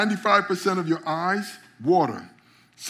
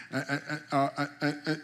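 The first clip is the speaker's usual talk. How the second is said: usual speaking voice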